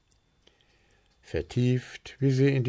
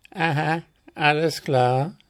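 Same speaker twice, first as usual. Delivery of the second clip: higher, lilting amusement; amused, knowing; 7 semitones higher, 4 dB louder than usual. A narrator's reading. Willi catching on to Puh's trouble, amused. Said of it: "schmunzelte Willi".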